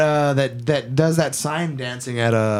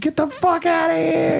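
much higher shrieking mom, imitated; screaming mom. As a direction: screech it like a furious mother catching her kid. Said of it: "starts crying, screaming".

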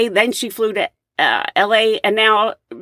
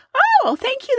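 touched surprise. Retold or imitated. Surprised by cappuccino gift.

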